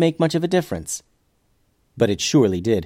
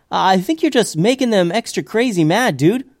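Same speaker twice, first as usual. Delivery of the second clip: exasperated friend; 7 semitones higher than usual. Acted. Tim warns during fight.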